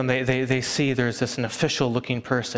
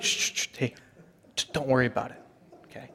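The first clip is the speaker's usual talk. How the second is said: hushing whisper; 6 dB quieter, faster than usual. Retold or imitated. Relative hushing again.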